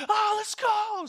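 high, loud cheering scream; explosive re-enacted whoop; hyped scream. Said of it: "I'm just literally screaming".

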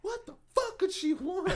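high, loud irritated outburst; high exasperated mock rant. Annoyed at mom calling.

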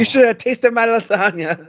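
high hammed-up Italian voice; Italian accent. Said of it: "Italian accent".

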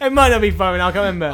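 normal talking voice

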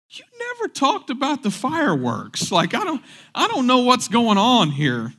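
high, bewildered kid voice, mock complaint; confused kid. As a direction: play a puzzled child who never got an answer. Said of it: "I'd be like".

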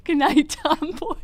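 high and loud through laughter; laughing. Real, not acted. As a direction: squeal it out while cracking up. Waltons bedtime joke, laughing.

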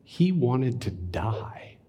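slow, hushed whisper; a preacher hushing the room over Jonah's despair. Acted. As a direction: breathe it out slowly.

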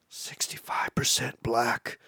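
hushed, low conspiratorial whisper; whispered aside. Retold or imitated. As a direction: lean in and whisper the number. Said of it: "the recruiter's like".

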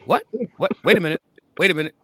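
stopping short as if caught off guard; startled double take. Retold or imitated. Reader caught off guard by the sister's book, startled. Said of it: "I'm like".

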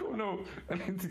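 mock panic. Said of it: "So I was like".

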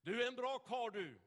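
loud, high, booming praise; loud and hearty. Retold or imitated. Burly man loudly praising preacher on tram, booming. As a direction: call it out for the whole tram. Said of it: "säger han högt och ljudligt".

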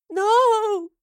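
loud, high wail of grief; anguished high "no"; weeping cry. A narrator's reading. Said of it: "Astrid wept".

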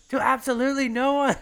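high, loud mock-sincere punchline with a laugh; McGregor punchline. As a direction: snap the fake apology shut, cocky. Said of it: "then goes".

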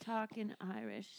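put-on Irish accent. Comic testing Irish before performing, hesitant Irish. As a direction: feel out a fake Irish lilt. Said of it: "can you do an Irish accent".

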